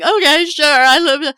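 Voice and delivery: high, loud mock-hysterical wail; imitating hysterical crying. Hysterical owner agreeing on the phone with the vet, mock hysterics. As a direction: blurt it out as if bawling, pitch way up. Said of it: "I'm hysterical. I'm going".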